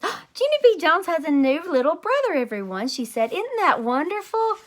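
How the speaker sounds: high, bright-eyed teacher gushing to the class; delighted teacher voice. Acted. Teacher announcing the baby news to class, high and gushing. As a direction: gush like a thrilled kindergarten teacher.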